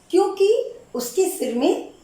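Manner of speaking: क्योंकि said high and rising; stress on क्योंकि. Shown as a demonstration. Teacher stressing the correct word for students, क्योंकि rising. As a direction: lift the word क्योंकि up sharply. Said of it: "नहीं की जगह होगा क्योंकि".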